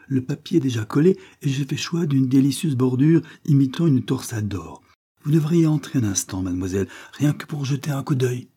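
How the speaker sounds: high, bright-voiced shopkeeper pleading his case; eager little suitor. A narrator's reading. Grocer courting Afi, showing off the parlour, eagerly.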